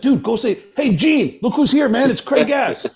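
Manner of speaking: imitating a high, excited shout-out; excited calling out. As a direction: call over to a star, high and animated. Acting Lopez calling out to Gene.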